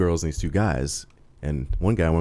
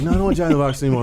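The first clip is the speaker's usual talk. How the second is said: fast, higher, louder rushed change of mind; impatient buddy; 8 semitones higher, 5 dB louder, much faster than usual. Retold or imitated. Teller imitating buddy in drive-thru line, rushed and eager. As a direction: blurt it like a hungry drunk friend.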